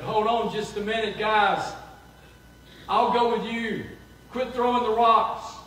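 a hint of a calm martyr; lightly voiced Stephen. Stephen voiced facing the mob.

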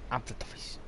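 hushed whisper of a slogan. Pretend. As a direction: whisper it like a secret cheer. Meme repeated in a whisper.

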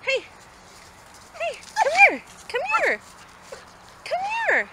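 high sing-song come here, calling. Real, not acted. Owner calling distracted dog Blue, high sing-song. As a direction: pitch way up to lure the dog over.

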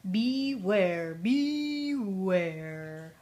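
long drawn-out booming horn notes. Acted. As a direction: voice the big horn as it blows. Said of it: "boomed the horn".

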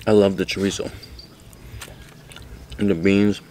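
eating between words; talking while chewing. Real, not acted. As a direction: mouth partly full of food. Seen 2: Eats throughout; spoonful at 2.8, lips closed chewing after; food unclear.

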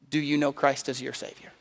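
ordinary delivery

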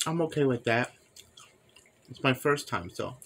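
slightly full mouth; casual snack talk; talking between bites of chips. Real, not acted. Seen 1: holds chip while talking, bites it at 2.7s.

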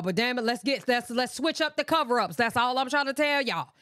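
normal talking voice

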